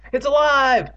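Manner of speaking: high, loud horrified cry. A narrator's reading. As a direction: wail it out high in terror. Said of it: "she cried".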